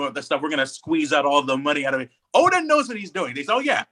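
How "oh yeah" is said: usual speaking voice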